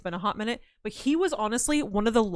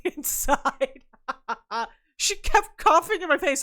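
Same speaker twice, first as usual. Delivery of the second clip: laughing; 5 semitones higher, slower than usual. Real, not acted. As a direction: laugh as you tell it.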